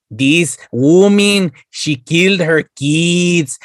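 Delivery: Three Amigos narrator. Retold or imitated. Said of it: "sounded like it was out of fucking Three Amigos".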